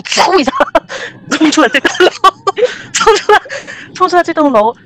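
giggling at how fast they ran; laughing retelling. Real, not acted. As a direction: laugh as you relive the panicked dash.

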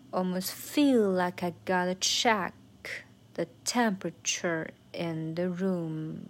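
slow, with "feel", "check", "temperature" accented; three stressed beats. Shown as a demonstration. Teacher finding the rhythm, stresses on "feel", "check", "temperature". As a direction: lean on "feel", "check" and "temperature", taking it slowly. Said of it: "有三个地方要重读".

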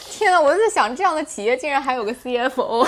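loud, high-pitched amazed outburst; amused disbelief. Real, not acted. Host reacts to the shady company having a CFO, amused disbelief. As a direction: exclaim in amused astonishment, pitched well up.

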